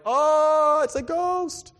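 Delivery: high panicky cry; panicked. Retold or imitated. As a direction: yelp it like terrified sailors. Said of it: "They screamed and panicked, like".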